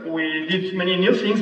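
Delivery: ordinary delivery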